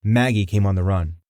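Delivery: ordinary delivery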